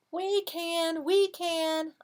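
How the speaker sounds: high, bouncy chant; eager helpers' answer. Acted. Animals answer the stuck duck, high chanted reply. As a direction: chime in high and loud for kids to join. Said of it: "what do you think the moose say".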